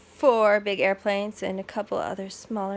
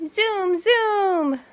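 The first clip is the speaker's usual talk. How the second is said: put-on high, loud toddler zoom; high, loud baby zoom noises; 12 semitones higher, 9 dB louder than usual. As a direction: play airplane like a baby. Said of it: "says Honey".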